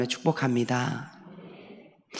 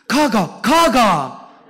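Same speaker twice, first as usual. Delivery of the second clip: higher and louder Gyeongsang dialect, the last 가가 drawn out slowly; 6 semitones higher, 5 dB louder, much slower than usual. Shown as a demonstration. Pastor showing the congregation the Gyeongsang dialect, higher and drawn out. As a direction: show off the Gyeongsang dialect with a punchy, exaggerated 가가. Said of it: "경상도 사투리로 네 글자로 줄여서 이렇게".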